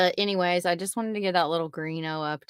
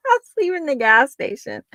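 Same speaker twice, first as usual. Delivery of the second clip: laughing; 10 semitones higher, 5 dB louder than usual. Real, not acted. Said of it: "laughing at herself".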